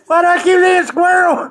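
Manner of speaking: very high, squeaky crying laughter; real laughter, near tears; helpless laughter. Real, not acted. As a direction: laugh so hard it turns to a squeal. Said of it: "about to fall out of the chair".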